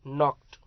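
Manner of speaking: नॉक्ड said as nokt, no k sound at the start, loud; silent k. Shown as a demonstration. Teacher shows students the silent k, loud and clear. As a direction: drop the k in नॉक्ड, begin straight on n. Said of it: "यहाँ के silent है".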